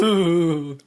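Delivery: spooky ghost ooh; ghost moan. Acted. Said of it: "Sounded like a ghost or something".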